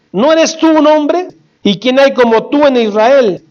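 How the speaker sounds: high-pitched retold taunt, lightly called out; David. David shames Abner before everyone, high taunting call. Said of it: "dio David voces al pueblo".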